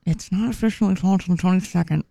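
talking with something in her mouth; words round a mouthful; mouth full. Real, not acted. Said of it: "Why are you talking like that? You got something in your mouth?".

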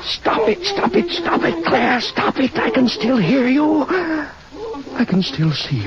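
high, tormented begging; anguished pleading. Acted. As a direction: beg the voice in your head to stop. Haunted by the dead wife's voice.

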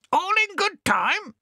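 very high, squawking shout; bad-tempered parrot. Cranky swagman parrot shouting at Bill. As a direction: snap back in a squawk.

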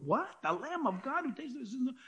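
soft, fast, questioning echo; hushed mock disbelief; puzzled muttering. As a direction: mutter it back as if baffled. Listener's puzzled reaction to John.

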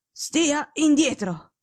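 a slow, firm, dragged-out order; a sick detective repeating his firm warning; slow imperious command. Acted. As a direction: lay down the law word by word. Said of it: "tono secco e imperioso".

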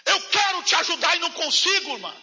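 retold rebuke. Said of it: "O que que eu falei pra ela".

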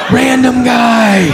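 high, drawn-out cry of alarm; mock panic. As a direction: stretch it out slowly in hammed-up dread. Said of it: "Now I'm panicked".

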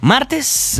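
high, drawn-out announcer's welcome; radio show opener. Real, not acted. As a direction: open the show with big radio showmanship. Host opens show as announcer. (locutor animado, agudo y alargado)

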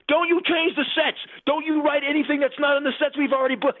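high-pitched, hammed-up yell of a panicked producer; mock screaming. Line producer yelling down the phone at the director, a high mock scream. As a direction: squeal the orders way up high, half laughing at him. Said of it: "he starts screaming on the phone".

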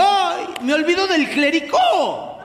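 high, slow mock exclamation; sudden remembering. Aunt at the party, high slow mock exclamation. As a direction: gasp it out like a forgetful aunt. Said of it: "le digo".

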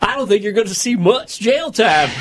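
mock Southern lawyer. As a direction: defend your client in a silly high Southern accent.

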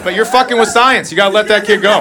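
normal talking voice

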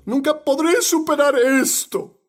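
lamento fingido, agudo; desesperanza imitada. Retold or imitated. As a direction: imita a quien se da por vencido, subiendo el tono. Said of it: "¿Cuántos han dicho eso?". (desesperanza fingida en voz aguda)

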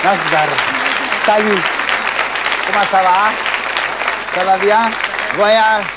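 loud, showy greeting to a foreign guest; booming host's hello; hearty welcome. Acted. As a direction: greet her loudly as if she were across the room. Greeting the French actress in French.

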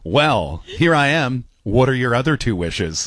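low, straight-faced come-on; pickup-line voice. Acted. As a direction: deliver it as a deadpan, low-pitched chat-up line. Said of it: "why do I do it in that voice".

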